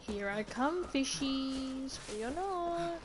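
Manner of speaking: teasing call with a long high ending; playful calling. Real, not acted. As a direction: sing out to the fish, ending high.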